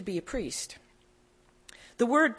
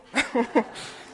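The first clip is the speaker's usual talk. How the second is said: breathy laugh; 3 semitones higher than usual. Real, not acted. Laugh after joke.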